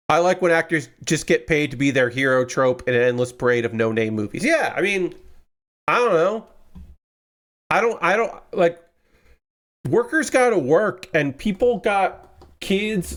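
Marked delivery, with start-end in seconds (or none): none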